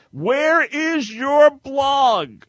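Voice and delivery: drawn-out high yell, every word stretched; all-caps typed shout. Retold or imitated. Host reading a listener's all-caps post, shouted. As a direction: read it out like furious capital letters, slowly. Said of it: "this is all in caps".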